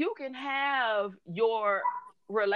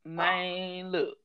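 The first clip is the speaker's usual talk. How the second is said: lightly imitated slow talker, soft and low; a hint of a slow-talking man; slow-talking client; 3 semitones lower, 5 dB quieter than usual. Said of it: "like, a slow talking person".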